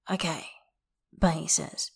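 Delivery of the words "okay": soft, hushed okay. A narrator's reading. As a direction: let it fall quietly. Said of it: "harsher than it means".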